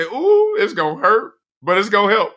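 high sing-song ooh, it's gonna hurt; teasing nurse. Retold or imitated. Nurses laughing as they warn him about the salt water, high sing-song. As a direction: tease it like a knowing nurse.

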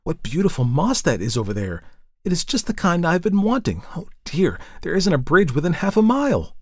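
put-on high, girlish enthusiasm turning to dismay; high-pitched eager exclamations; excited exclaiming. A narrator's reading. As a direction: gush eagerly, then lament. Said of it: "cried Elsie".

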